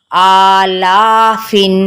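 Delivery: आ ला फिन with आ and ला equally long; equal stretching. Shown as a demonstration. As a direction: slowly hold आ and ला for the same length. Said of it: "दोनों को equal खींचना है". (आ और ला दोनों बराबर खींचें)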